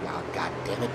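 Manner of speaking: lightly put-on American accent, low and quiet; a quick mock American groan; muttered American "Oh, God damn it". Shown as a demonstration. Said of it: "that's the accent I would use".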